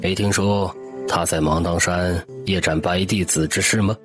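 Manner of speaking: knowing, mysterious. A narrator's reading. Xiao He enlightens newcomer Lu Wan, knowing and mysterious. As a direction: confide it with understated mystery. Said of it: "萧何神秘地笑了笑道".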